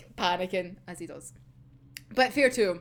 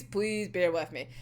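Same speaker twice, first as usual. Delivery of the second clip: ordinary talk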